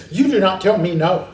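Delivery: loud, indignant mock reprimand; stern and forceful, each word driven home; scolding parent. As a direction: imitate a parent telling off a baby. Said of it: "you'll hear 'em out there".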